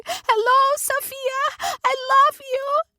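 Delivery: very high joyful shout; screaming. Acted. Sister yelling from the background, ecstatic shouting. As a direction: scream it across the room. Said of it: "my sister screaming in the background, shouting".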